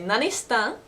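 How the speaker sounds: Osaka dialect. Shown as a demonstration. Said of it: "in Osaka Ben, you can say".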